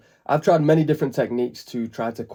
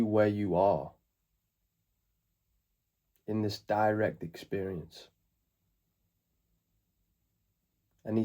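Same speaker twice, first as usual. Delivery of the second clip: calm meditation guide, long pauses between phrases; spacious, settling voice, growing softer toward the end; guided meditation; 4 dB quieter than usual. Acted. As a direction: ease them into the present moment, unhurried.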